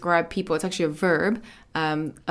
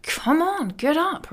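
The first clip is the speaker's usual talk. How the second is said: higher-pitched, impatient nagging to get up; exasperated plea, pitched up; annoyed urging; 9 semitones higher than usual. Acted. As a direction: nag a sleeper, voice pushed up high. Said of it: "she gets kind of a bit annoyed".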